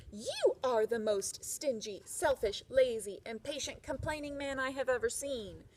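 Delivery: crying out. Acted. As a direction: let the wife finally explode at him. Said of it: "his wife cried out".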